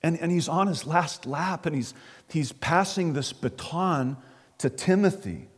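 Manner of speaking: ordinary delivery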